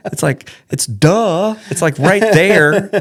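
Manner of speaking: high, slow, laughing duh; laughing exasperation. Real, not acted. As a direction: stretch it out, amused at the obvious. Exasperated aside.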